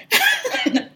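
short high-pitched laugh. Real, not acted. Woman recalling the unknown letter, high laugh. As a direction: burst of disbelieving laughter.

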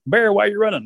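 playful call to a runner; mock calling out. Storyteller improvises for his dinner friend, mock calling out.

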